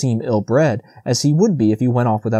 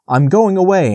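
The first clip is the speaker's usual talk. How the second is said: firm answer; 7 semitones higher, 5 dB louder than usual. A narrator's reading. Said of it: "answered Bambi".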